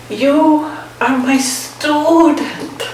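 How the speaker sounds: a teacher's slow, high, exasperated reminder; lightly voiced weary teacher. Zen teacher to his lovesick student, slow and exasperated. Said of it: "kind of exasperatedly".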